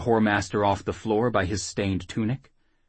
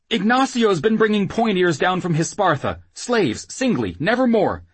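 high, louder frightened gabble; breathless confession; 11 semitones higher, 5 dB louder than usual. Acted. Whoremaster confessing at knifepoint, breathless frantic confession. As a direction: spill it all in one high, anxious stream. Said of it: "talk without breathing".

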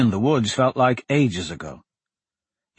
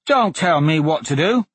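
put-on defiant boy; 5 semitones higher, 3 dB louder than usual. A narrator's reading. George voiced, defiant.

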